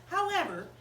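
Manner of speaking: ordinary delivery, nothing marked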